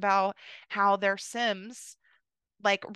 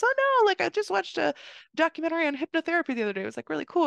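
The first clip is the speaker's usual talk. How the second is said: gushing congressman; 7 semitones higher, faster than usual. Retold or imitated. Congressman gushing about hypnotherapy.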